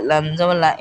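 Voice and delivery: ordinary talk, nothing marked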